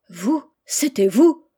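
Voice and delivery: astonished cry. A narrator's reading. General learning his rescuer stands before him, astonished cry. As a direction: cry out in amazement at the revelation. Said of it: "s'écria le général".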